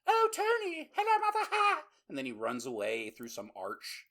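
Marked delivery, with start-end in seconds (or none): 0.1-1.8 high, loud squealing kid mimicry; piercing mock-child squeal; Tony. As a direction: shriek it as a hammed-up obnoxious kid. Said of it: "Tony's just running around like a fucking asshole, like".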